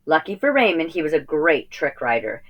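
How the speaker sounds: usual speaking voice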